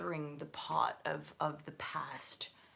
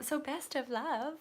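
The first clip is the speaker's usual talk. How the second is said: much higher, a touch louder; bright sign-off; 9 semitones higher, 3 dB louder than usual. Real, not acted. Reader blessing the querent, bright and much higher. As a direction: sing it out as a cheery farewell.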